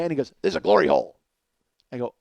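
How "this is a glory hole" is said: low, loud grouchy growl; full-throated gruff puppet grump; Oscar the Grouch. Acted. Stranger explaining through a hole in the wall, grouchy. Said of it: "full-throated his voice is".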